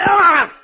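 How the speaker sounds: sudden high gasp of shock; startled choking cry. Acted. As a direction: cry out as hands grab your throat. Villain seized by the throat.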